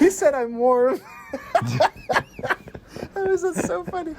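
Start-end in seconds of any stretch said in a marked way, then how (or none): 0.0-1.0 high-pitched quote breaking into laughter; slow, giggly mock voice; laughing quote. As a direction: crack up while doing the character's line. Quoting film line, cracking up.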